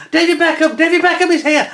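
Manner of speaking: very high, loud fan shriek; mock screaming tourist, high and loud; imitated excited shouting. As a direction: shout the news like a hysterical fan. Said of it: "And she's shouting".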